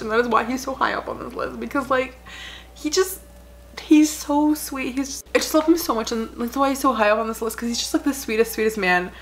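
fighting back tears while gushing; tearful. Real, not acted. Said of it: "I'm coming over to see me 'cause I'm crying".

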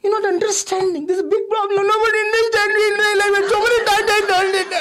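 put-on high, fast, whiny sobbing kid; hammed-up wail of a misunderstood child; mock crying child. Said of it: "why are you crying".

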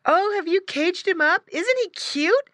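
put-on very high, excited little girl voice; exclaiming sister. A narrator's reading. Sister seeing the caged pig, excited. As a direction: gush over the cute pet. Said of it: "exclaimed one of the boy's sisters".

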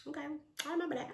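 quick, high put-on voice; playful grudge. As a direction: toss it off high and fast. Playful vow to remember the slight.

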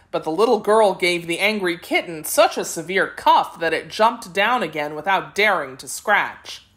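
plain reading voice

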